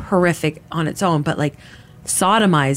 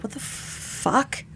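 slow, hushed, almost voiceless; retold stunned disbelief; 5 semitones higher, 9 dB quieter, much slower than usual. Uncomfortable woman reacting to the grab, stunned whisper. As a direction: mouth it in a drawn-out whisper.

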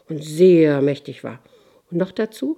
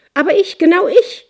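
very high, loud roaring fury; bellowing king; 12 semitones higher, 9 dB louder than usual. Acted. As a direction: bellow it, beating his chest. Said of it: "brüllte der König".

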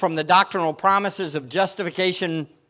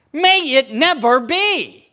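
raised and slowed, every word weighed; emphatic proclamation; 7 semitones higher, 6 dB louder, slower than usual. Acted. Preacher echoing Paul to the congregation, emphatic and slow. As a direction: proclaim it like Paul's outraged denial. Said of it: "Paul answers with the emphatic what".

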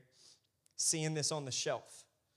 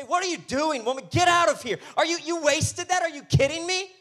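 outraged, high-pitched yelling; loud, high, scandalized outburst; freaking out; 13 semitones higher, 12 dB louder than usual. Acted. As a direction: yell in shocked indignation at what she did. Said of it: "they begin to freak out".

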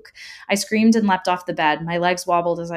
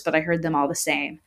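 ordinary delivery

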